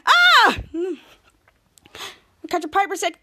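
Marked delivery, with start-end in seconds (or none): 0.1-0.6 a sudden, high, loud shriek of alarm; panicked scream. Retold or imitated. Henry hearing the doorbell, a loud high panicked shriek. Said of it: "Henry's like".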